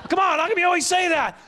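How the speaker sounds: high, exasperated mock shout; arguing husband. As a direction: protest in a staged marital spat. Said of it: "We've had some screaming matches".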